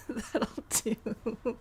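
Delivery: amused, words breaking into laughter; laughing. Real, not acted. Streamer amused by their own quote, laughing. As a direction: say it through giggles. Laughs while quoting a line.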